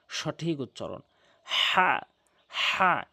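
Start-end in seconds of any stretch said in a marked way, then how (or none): 1.4-3.0 হা breathed from the middle of the throat with warm air. Shown as a demonstration. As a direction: push হা out from the throat, pressed. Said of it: "এভাবে উচ্চারণ করবেন".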